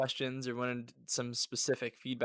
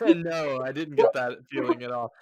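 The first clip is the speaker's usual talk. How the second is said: laughing through the words, much louder; 2 semitones higher, 10 dB louder than usual. Real, not acted. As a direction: answer while still laughing. Host laughing at guest's joke.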